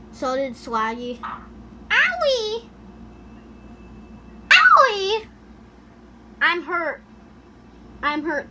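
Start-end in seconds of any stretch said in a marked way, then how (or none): 1.9-8.5 high squeaky whiny owie; hammed-up cry of pain; mock pain yelps. Game character getting hit.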